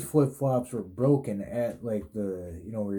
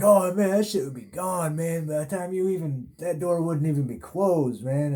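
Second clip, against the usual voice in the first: mock inmates; 7 semitones higher than usual. Inmates retold, teasing him.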